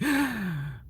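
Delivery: sharp startled intake of breath; quick mock-alarmed gasp. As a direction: gasp as if the van lurched. Reacting to big rig driving.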